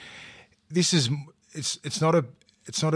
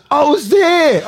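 put-on high, loud kid voice; cheeky kid; 13 semitones higher, 8 dB louder, slower than usual. Young Jamal teasing him about the Mish, high loud kid voice. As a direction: grin it out like a cheeky young boy. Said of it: "He goes".

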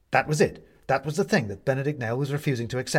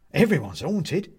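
indignant; 7 semitones higher, 5 dB louder, slower than usual. A narrator's reading. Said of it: "a little indignantly".

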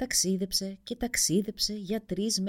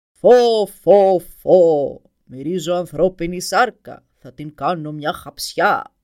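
booming, slow giant voice; ogre roar; 16 dB louder, much slower than usual. A narrator's reading. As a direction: roar like a hungry dragon. Giant dragon roars in. (βροντερή φωνή δράκου που βρυχάται)

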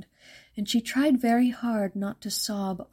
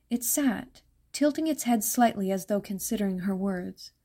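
ordinary delivery, nothing marked

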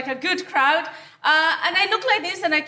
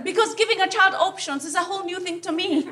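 usual speaking voice

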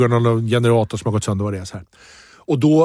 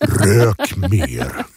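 deep restored voice; 7 semitones lower than usual. Retold or imitated. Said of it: "kom den tillbaks".

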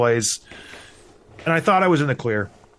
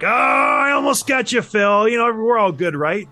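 mock playful shout; 6 semitones higher than usual. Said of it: "I'm like".

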